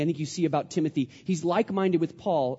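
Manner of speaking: usual speaking voice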